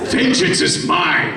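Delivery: gloating whispered cry of victory; hammed-up gloat; mock villain triumph. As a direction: crow it like a cartoon villain. Gloating at snobbish fans.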